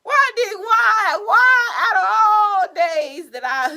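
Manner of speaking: high wailing complaint; exasperated lament. Real, not acted. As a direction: wail it in comic despair. Lamenting the stubborn card.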